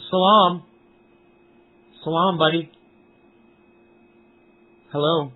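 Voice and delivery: ordinary talk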